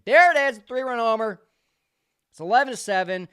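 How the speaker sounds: loud, rising call of the home run; sudden loud call as the ball leaves the park; excited home run call. Real, not acted. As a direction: belt it out like a broadcaster calling a homer.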